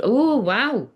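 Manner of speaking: ordinary delivery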